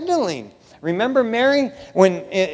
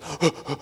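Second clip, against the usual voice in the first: rapid mock panting; imitated recovering pant; 5 semitones lower, 6 dB quieter than usual. Preacher mimics the rescued sheep back on its feet, puffing. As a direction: pant like a sheep just set upright. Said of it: "gets back on his feet and goes".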